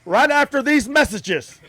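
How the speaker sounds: slow, loud, high TV announcer. Pretend. Host going to break, booming announcer voice.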